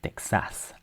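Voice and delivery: Texas softly as tek-SAS; full French vowels. Shown as a demonstration. Teacher shows French vowels, fully sounded Texas. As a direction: give Texas a full open A at the end. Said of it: "we say the vowels fully".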